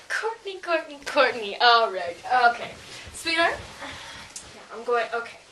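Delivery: low, soft patronizing coo; mock soothing. Girl mockingly soothes her friend Courtney. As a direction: talk down to her like a child.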